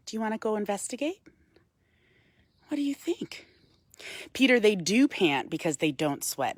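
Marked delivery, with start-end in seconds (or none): 2.7-3.5 hushed whispered question; curious whisper to the raven on her glove. Real, not acted. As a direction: ask it softly, breath only.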